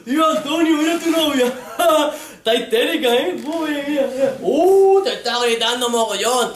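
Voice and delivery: voces de curiosos, agudas y fuertes; gawking bystanders. Retold or imitated. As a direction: cotillea escandalizado. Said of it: "Y la gente". (la gente comentando, escandalizada)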